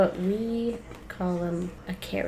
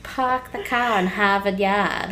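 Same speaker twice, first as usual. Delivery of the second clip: Boston accent; 4 dB louder than usual. Shown as a demonstration. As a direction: say it like a Boston local, Rs swallowed. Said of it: "with your accent".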